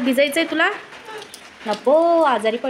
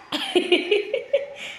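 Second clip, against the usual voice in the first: high laugh; 9 semitones higher, 4 dB quieter than usual. Real, not acted. Laughs at toddler's refusal.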